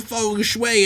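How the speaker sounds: "feng shui" said high and drawn out as foong-shway; standard pronunciation. Shown as a demonstration. Reader protesting the spelling of "feng shui", exasperated fung-shway. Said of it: "it's not spelled".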